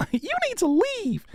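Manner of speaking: very high put-on voice; mock falsetto dismissal. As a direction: throw them out in a playful falsetto. Wig persona, mock dismissal.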